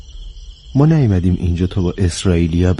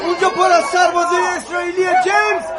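high, frantic shouted warning; desperate alarm, pitched way up; panicked warning. Acted. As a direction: scream after a friend heading into danger. Warning him away from soldiers.

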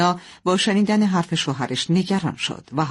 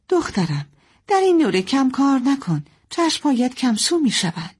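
higher-pitched, caring mother; lightly voiced mother; 8 semitones higher than usual. Mother warning her daughter about working in dim light, lightly voiced. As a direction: gently caution a daughter, higher.

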